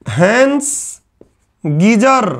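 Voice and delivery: Hence and geyser said as two separate words with a pause between; name split apart. Shown as a demonstration. Teacher making students memorise the technician's name, split apart.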